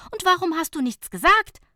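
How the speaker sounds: high, loud defiant snap; defiant retort. A narrator's reading. Fabienne snapping back at Nicola, defiant and loud. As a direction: fire it back stubbornly. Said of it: "gab Fabienne trotzig zurück".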